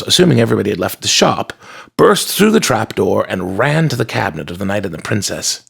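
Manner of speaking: usual speaking voice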